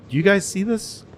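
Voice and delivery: imitating a worried wife asking the room; alarmed plea. Nancy alarmed at the meeting.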